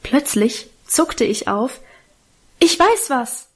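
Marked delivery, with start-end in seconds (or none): 2.6-3.5 sudden eager blurt, pitched up; half-loud blurt. A narrator's reading. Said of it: "rief ich halblaut". (plötzlich halblaut herausgeplatzt)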